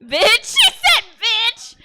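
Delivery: sassy triumph. Retold or imitated. Triumphant inner quote.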